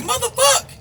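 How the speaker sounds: sudden high shocked yelp; a high, startled curse; shocked outburst. Retold or imitated. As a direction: burst out in disbelief. Shocked at being suspected.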